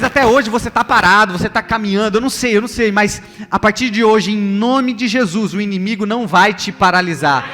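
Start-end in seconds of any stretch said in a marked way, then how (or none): none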